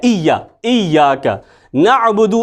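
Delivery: slow «इय्याका नअबुदु» with a clear hamza and a throaty ain; careful recitation. Shown as a demonstration. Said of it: "यहाँ पे आइन है".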